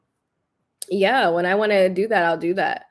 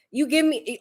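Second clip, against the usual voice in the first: normal talking voice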